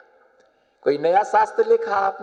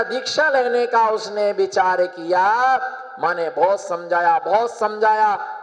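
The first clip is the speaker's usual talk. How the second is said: ordinary delivery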